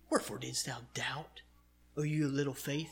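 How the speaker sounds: soft whisper fading into a hushed question; whispered rebuke. Acted. Jesus gently questioning Peter, soft whispered rebuke. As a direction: hush it, almost no voice.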